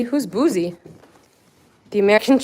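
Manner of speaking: ordinary talk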